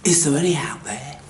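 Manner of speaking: hushed, searching, no voice; whisper. Acted. Seeker whispers into the empty world, hushed. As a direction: whisper it into the dark.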